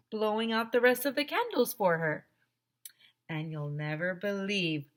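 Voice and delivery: plain reading voice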